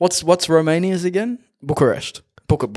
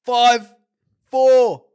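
game show countdown; 9 semitones higher, slower than usual. Acted. Quiz host countdown.

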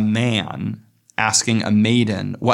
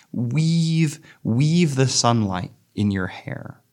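slow, measured reading of the verse; poem reading; 3 semitones higher, slower than usual. Acted. Lecturer reading the Eliot poem to the class, measured and slow. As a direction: let each line of the poem breathe. Reads the repeated weave.

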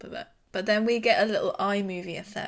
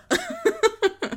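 high, loud laughing ugh; disgusted ugh; 8 dB louder than usual. Retold or imitated. Host replaying her grossed-out reaction with a high ugh. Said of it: "And I was like".

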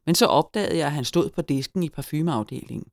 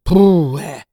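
loud, blown-out phew; exclaimed deep sigh; 3 semitones higher, 12 dB louder than usual. A narrator's reading. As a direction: puff it out in relief. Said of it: "udbrød han og sukkede dybt".

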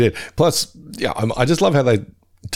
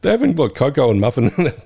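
ordinary talk

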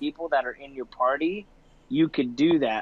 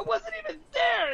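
put-on much higher-pitched kid voice protesting his innocence; kid protesting to mom. Gamer kid calls out to his mom mid-match, high protesting voice. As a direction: squeal it like a teen caught out by his mom.

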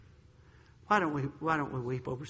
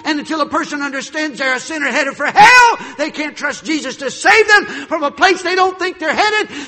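shouted, much higher and louder preaching; full-force preaching; 11 semitones higher, 17 dB louder than usual. Acted. Preacher shouting about hell and salvation. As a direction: thunder it out from the pulpit.

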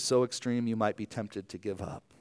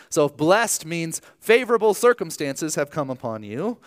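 ordinary talk, nothing marked

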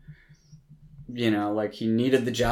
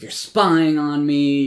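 slow, loud, higher mock accusation; retold angry charge, slowed and raised; 5 semitones higher, 7 dB louder, slower than usual. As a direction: spell out each word like an accusation. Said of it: "he's angry".

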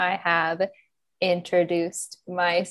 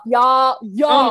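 mock Southern; 4 semitones higher, 8 dB louder than usual. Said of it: "I'm gonna start using y'all".

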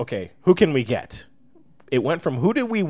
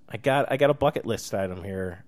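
normal talking voice

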